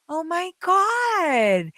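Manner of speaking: high, very slow, drawn-out gasp of amazement; stunned John. Retold or imitated. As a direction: imitate John's awestruck slow oh my God.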